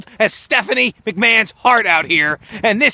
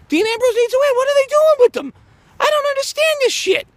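much higher, squeaky disbelief; high-pitched frustrated complaint; exasperated high rant. Real, not acted. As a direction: whine it in frustration. Exasperated rant about booking.

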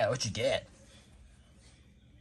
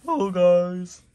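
high, loud goofy starfish greeting; loud, high Patrick saying hello to the viewers; 7 semitones higher, 10 dB louder, slower than usual. Acted. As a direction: boom it out like a dopey Patrick. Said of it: "Here is Patrick".

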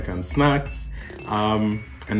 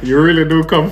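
laughing; 5 semitones higher, 7 dB louder than usual. Retold or imitated. Mock teasing after her realisation.

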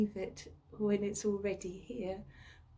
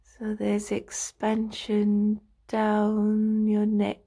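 slow meditation voice with drawn-out words and long pauses; guided relaxation; 4 dB louder than usual. Acted. Practitioner talking a listener into lying down and stretching, slow guided meditation. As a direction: guide them through the body slowly, stretching each phrase.

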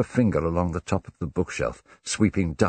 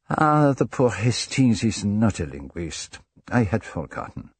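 put-on higher, slow accented indulgence; Poirot, smiling; 5 semitones higher, 5 dB louder than usual. A narrator's reading. Poirot apologises for Hastings the non-linguist, smiling. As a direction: excuse him with a smile. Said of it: "Poirot smiled".

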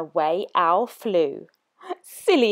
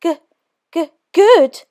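high, loud frightened stammer; stammering mouse; 8 semitones higher, 5 dB louder than usual. Acted. Mouse answers the Gruffalo, stammering in fear. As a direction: stutter it in fear. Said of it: "said the mouse".

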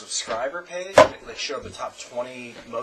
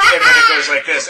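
loud, laughing vlogger impression; louder mock vlogger voice; imitating Blunty; 12 dB louder than usual. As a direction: blast it like the vlogger's next cut. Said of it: "he talks like this".

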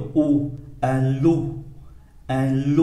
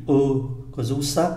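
ordinary delivery

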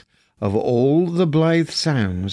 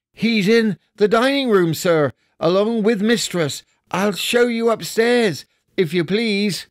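put-on higher, polite young girl voice; little maid; 8 semitones higher than usual. A narrator's reading. Maid answering Scrooge, higher and polite. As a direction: bob a curtsy in your voice.

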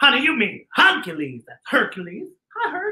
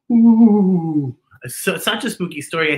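normal talking voice

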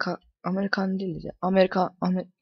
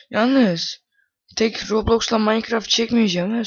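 raised announcing voice to viewers; loud announcement; 3 semitones higher, 7 dB louder than usual. Real, not acted. Gamer telling viewers about upcoming videos, loud. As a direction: call it out loud to the audience. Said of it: "söyleyeyim size".

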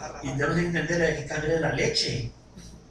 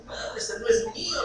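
much higher, squeaky comic voice; mock husband's protest. Pastor retelling his husband's joke about the crying baby, mock high voice. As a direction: squeak it out like a baffled dad. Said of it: "Otra vez, decía".

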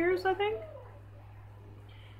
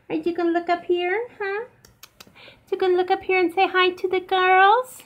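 pet talk; 6 semitones higher, 6 dB louder than usual. Real, not acted. Owner cooing higher at the dogs, asking them to say hi.

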